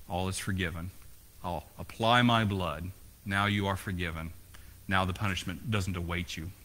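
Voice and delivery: soft, hushed voice of forgiveness; a quiet, tender pardon. Acted. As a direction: speak it softly as God forgiving.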